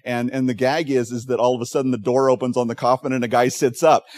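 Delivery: ordinary talk, nothing marked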